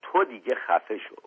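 usual speaking voice

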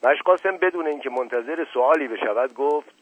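usual speaking voice

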